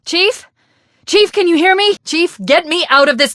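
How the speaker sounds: high, urgent calls to a silent partner; anxious high-pitched calling; calling out anxiously. Acted. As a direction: try to raise him, getting worried. Calling out to unresponsive Chief.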